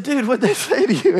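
high, laughing question at the friend; laughing disbelief. Retold or imitated. Pastor asking friend what the extremists said, laughing. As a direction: laugh through it, amazed. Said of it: "I said".

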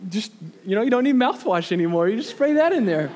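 mocking the salesman's pitch, laughing. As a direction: joke it like an ad.

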